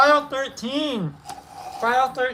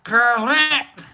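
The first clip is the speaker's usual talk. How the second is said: proud Scot ranting; mock Scottish accent; 2 semitones higher, slower than usual. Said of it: "paying attention more to my goddamn accent".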